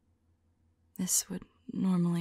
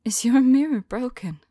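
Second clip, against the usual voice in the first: sudden burst out of the whisper, louder and higher in alarm; startled question; 5 semitones higher, 11 dB louder than usual. Acted. Vampire seeing her flushed face, asks loudly in alarm. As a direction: jolt up out of the hush in shock.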